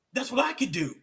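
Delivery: bursting with the thrill of discovery; excited realization. Real, not acted. Recalls his aha moment.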